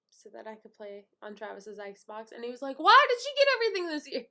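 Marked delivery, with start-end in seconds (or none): 2.8-4.3 high, loud, rapid complaint; hammed-up envious wail; jealous whining. Brother complaining about sister's gifts, jealous whine.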